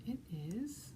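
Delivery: hushed teasing whisper; secretive whisper before the answer; suspense whisper. Acted.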